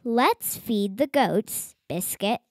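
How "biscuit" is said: plain reading voice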